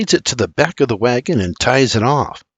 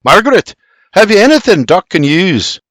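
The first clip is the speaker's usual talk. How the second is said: loud, raised urgent question; desperate demand; 6 semitones higher, 5 dB louder than usual. A narrator's reading. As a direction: demand help from Margaret. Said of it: "he billows as he desperately searches".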